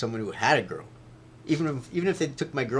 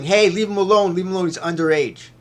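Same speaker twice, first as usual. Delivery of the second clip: high, loud protective yell at strangers; mother yelling; 7 semitones higher, 9 dB louder than usual. Retold or imitated. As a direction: shout to defend her son, much louder. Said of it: "starts yelling".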